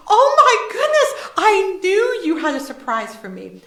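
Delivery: put-on delighted boy. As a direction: squeal like a thrilled seven-year-old. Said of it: "And the little boy says".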